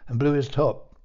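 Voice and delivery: ordinary delivery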